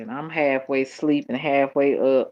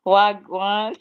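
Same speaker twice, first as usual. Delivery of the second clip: higher, questioning attempt at a Jamaican greeting; attempted Jamaican accent; 5 semitones higher, 4 dB louder than usual. Shown as a demonstration. Streamer unsure she is saying it right, tentative Jamaican accent. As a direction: have an unsure go at Jamaican, pitched up at the end. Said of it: "I don't know if I'm saying it".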